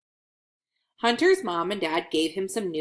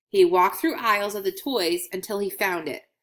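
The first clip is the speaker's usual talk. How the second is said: ordinary delivery, nothing marked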